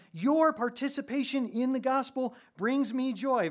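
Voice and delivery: loud, high, fired-up preaching; impassioned preaching. Acted. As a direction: preach it with rising excitement. Preaching at full force, excited.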